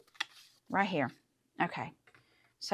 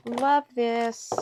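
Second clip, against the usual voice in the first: higher, louder gushing repeat; excited; 5 semitones higher, 6 dB louder than usual. Real, not acted. Crafter admiring her stamped circle, excited gush. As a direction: burst out with delight.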